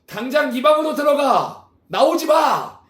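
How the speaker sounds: angry father yelling at his son; furious shout sending the boy to his room. Acted. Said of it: "소리 지릅니다".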